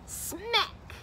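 high comic smack; sound effect. A narrator's reading. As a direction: slap the word out like a bump. Sound effect word in story.

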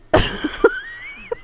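a very high breathless squeal of laughter. Real, not acted. Streamer squealing after a jump scare.

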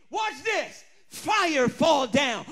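slow, loud, drawn-out command; commanding shout. Acted. Elijah to the crowd, a slow booming command. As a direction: call the fire down from heaven.

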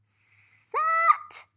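high, loud "Sat" announced; excited blend. Shown as a demonstration. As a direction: cheer out "Sat" as a discovery. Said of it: "and it says".

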